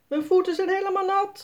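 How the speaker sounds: put-on high, fast girl's squeal. A narrator's reading. Sanne squeals to Jasper as waves soak her feet, high and fast. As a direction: squeal as the cold wave hits. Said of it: "gilt Sanne".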